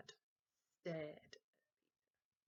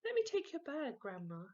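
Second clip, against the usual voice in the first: put-on higher, sugary little boy; sweetly; 8 semitones higher, 6 dB louder than usual. Henry buttering up Grandma, sweet and higher. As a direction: lay the sweetness on thick. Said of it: "said Henry sweetly".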